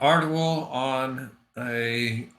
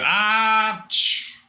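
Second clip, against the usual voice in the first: sleepy hush; 10 semitones higher, 3 dB louder than usual. Acted. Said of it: "You just go".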